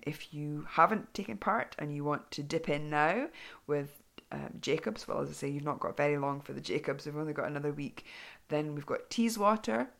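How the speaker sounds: ordinary talk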